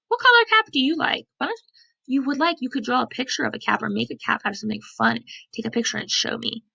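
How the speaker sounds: plain reading voice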